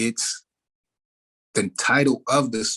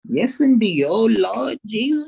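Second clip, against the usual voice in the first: put-on much higher old Southern church lady, exclaiming; 11 semitones higher, 3 dB louder than usual. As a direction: exclaim like an elderly churchgoing auntie. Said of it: "how some old folks say it".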